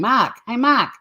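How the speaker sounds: Boston caller shouting Mark, bird-like. Retold or imitated. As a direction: call Mark out high in a Boston accent. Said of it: "making bird noises by going".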